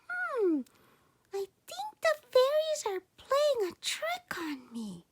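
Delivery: put-on high whispered boy voice, thinking aloud; a small boy muttering his doubt in a whisper; boy's suspicion. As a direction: Sandangao suspecting the fairies, high and whispered. Sandangao realizes fairies' trick.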